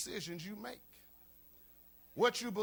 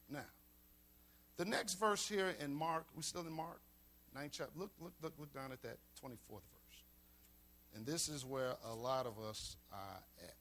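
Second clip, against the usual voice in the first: usual speaking voice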